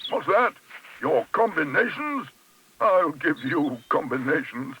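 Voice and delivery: high, slow incredulous indignation; outraged. Acted. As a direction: repeat it with mounting, drawn-out outrage. Trapped neighbour explodes in outrage.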